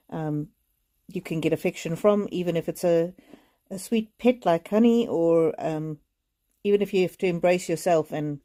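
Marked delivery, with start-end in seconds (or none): none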